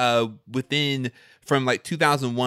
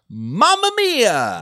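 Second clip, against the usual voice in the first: exclaimed title; 9 semitones higher, 6 dB louder, slower than usual. Shown as a demonstration. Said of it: "You have to scream it".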